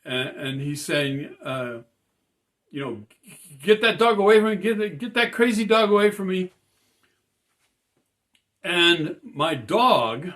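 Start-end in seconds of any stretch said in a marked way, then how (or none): 3.6-6.5 higher, louder, hurried plea; mock the man's near-tearful yelling; imitated panicked screaming. Man on the car roof begging for rescue from the dog, imitated panicked screaming. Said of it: "he's screaming, uh, almost crying, screaming".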